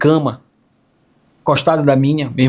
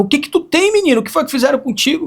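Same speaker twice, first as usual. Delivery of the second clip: high, anxious mother's voice, much higher; worried mother; 12 semitones higher, faster than usual. Retold or imitated. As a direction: play the mother asking her son what happened. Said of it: "A minha mãe perguntava". (voz de mãe preocupada, bem mais aguda)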